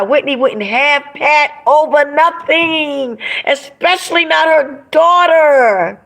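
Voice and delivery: high, drawn-out outraged protest; emphatic indignation. Real, not acted. Friend defending [name] over her daughter, indignant. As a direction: stretch the key words high and insistent.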